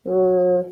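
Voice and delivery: "r" held as one long, level rrr; isolated r sound. Shown as a demonstration. Teacher swaps the beginning sound, "r" drawn out as rrr. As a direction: sustain "r" alone, the sound that starts rat. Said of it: "like a rat".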